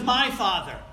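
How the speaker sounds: loud, punched "My Father"; preacher driving home MY Father; emphatic contrast. Real, not acted. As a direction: proclaim the words "My Father" forcefully.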